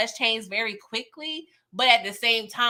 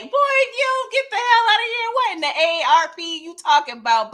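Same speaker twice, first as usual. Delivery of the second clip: higher, louder mock scoff; mock scoffing, pitched up and loud; scoffing outburst; 11 semitones higher, 5 dB louder than usual. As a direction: call out his nonsense loudly. Scoffing at Unique retiring.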